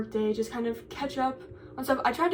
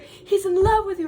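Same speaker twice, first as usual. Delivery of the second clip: high, loud, sing-song tease; teasing friend; 9 semitones higher, 7 dB louder than usual. Retold or imitated. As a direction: tease her like a giddy friend. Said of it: "And she's like".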